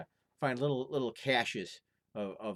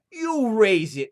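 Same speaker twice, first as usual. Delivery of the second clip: much higher, loud and slow; defiant retort; 11 semitones higher, 14 dB louder, much slower than usual. Acted. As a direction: throw it back at the giant. Lad defies giant.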